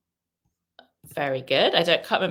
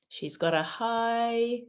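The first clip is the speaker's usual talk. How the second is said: "high" held open and slow; hanging pause; 5 semitones higher, 5 dB quieter than usual. Shown as a demonstration. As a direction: leave "high" unfinished for learners to fill. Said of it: "think about how you say it as well".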